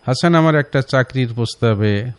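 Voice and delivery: ordinary talk, nothing marked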